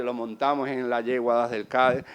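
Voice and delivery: ordinary delivery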